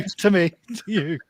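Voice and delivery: sing-song double-act catchphrase; catchphrase impression. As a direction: draw it out like a ladder routine. Comic catchphrase from ladder routine.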